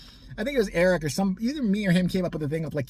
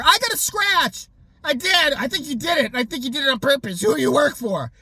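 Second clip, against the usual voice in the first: paranoid neighbour; 9 semitones higher, 5 dB louder than usual. Retold or imitated. Mocking the neighbour accusing him over her car, high and loud.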